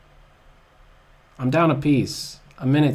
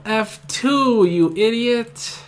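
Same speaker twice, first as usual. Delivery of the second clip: self-scolding; 7 semitones higher, 4 dB louder, slower than usual. Real, not acted. Scolding own move.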